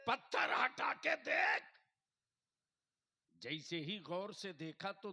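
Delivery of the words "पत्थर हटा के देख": high, loud preacher's order; shouted command. Acted. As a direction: hammer out the command at full voice. Preacher repeats command at full force. (ज़ोरदार ऊँची आवाज़ में हुक्म)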